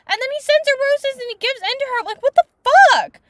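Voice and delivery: high, loud indignant rant; real outrage. Real, not acted. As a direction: build to an incredulous shout. Outraged rant at plot turn.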